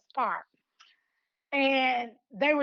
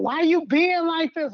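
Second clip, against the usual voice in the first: fussing out; 3 semitones higher, 7 dB louder than usual. Retold or imitated. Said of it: "fussing her out".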